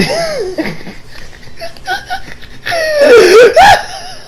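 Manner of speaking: long, high wheezing laugh; real laughing fit. Real, not acted. Losing it over the car scene, real helpless laughter. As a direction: crack up helplessly. Cracks up at his retelling.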